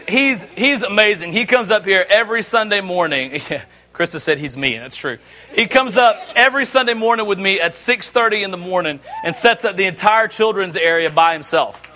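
usual speaking voice

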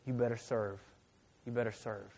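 low, quiet words echoing in the head; remembered inner voice. Retold or imitated. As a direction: repeat it softly, like a nagging thought. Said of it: "those words came up in my head".

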